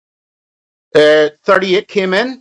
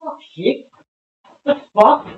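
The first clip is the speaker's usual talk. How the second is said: ordinary talk, nothing marked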